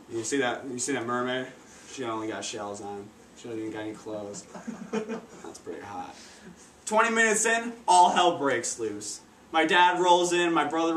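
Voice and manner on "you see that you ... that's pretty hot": low, soft, leering creep; creepy leering voice. Retold or imitated. Leering commentary on the mermaid.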